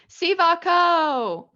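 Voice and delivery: long drawn-out high call of Sivako; yelling Sivako. Shown as a demonstration. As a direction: stretch it out like a ride cheer. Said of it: "I love yelling".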